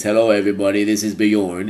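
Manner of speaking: lightly imitated joke voice, on a level pitch; funny voice. Vlogger imitating Benny posing as Bjorn, funny voice. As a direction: put on a slightly silly mock introduction. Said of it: "in a funny voice".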